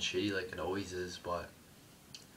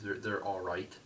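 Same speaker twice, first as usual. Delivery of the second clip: normal talking voice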